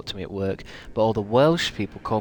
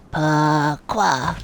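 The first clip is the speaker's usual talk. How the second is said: higher, louder mock French pourquoi; 5 semitones higher, 5 dB louder than usual. Streamer blurts French at the game, mock French. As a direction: toss out a hammed-up French Pourquoi.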